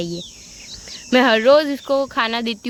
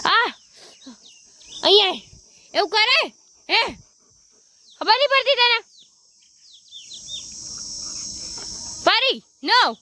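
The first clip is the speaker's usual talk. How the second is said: high, exasperated scolding of a pet; 11 semitones higher than usual. Real, not acted. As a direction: tell off the dog in a raised pitch. Scolding the dog in Gujarati.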